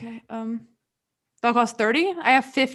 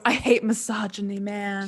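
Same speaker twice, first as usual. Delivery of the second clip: ordinary talk, nothing marked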